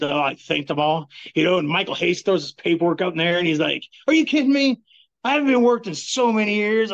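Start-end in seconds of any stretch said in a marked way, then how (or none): 4.1-6.9 mock outrage at being fooled completely; disbelieving outburst. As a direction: official fooled by the fake injury, disbelieving outburst. Angry official who was fooled backstage.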